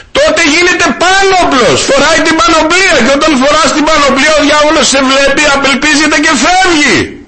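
full-force sermon delivery; preaching. Acted. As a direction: preach it out to the congregation. Preaching to the congregation.

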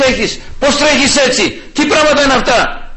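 high, indignant telling-off; acted angry scolding. Preacher acting out the road row he avoided, angry scolding. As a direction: act out snapping back at a reckless driver.

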